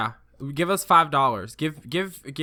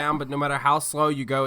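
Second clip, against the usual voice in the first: normal talking voice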